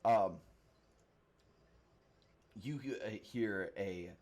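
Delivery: ordinary talk, nothing marked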